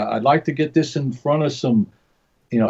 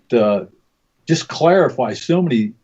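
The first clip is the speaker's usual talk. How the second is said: ordinary talk, nothing marked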